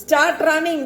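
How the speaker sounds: devil urging. Acted. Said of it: "the devil is telling".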